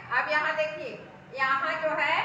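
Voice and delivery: normal talking voice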